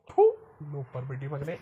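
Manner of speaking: low, contained contempt; dark, fuming father; disgusted cursing. Acted. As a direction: spit out the insult under your breath. Father curses son on hearing news.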